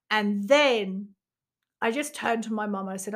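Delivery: ordinary delivery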